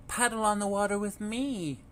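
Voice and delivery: sing-song calling mother duck. A narrator's reading. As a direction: call them over, mama duck. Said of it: "Mama called once more".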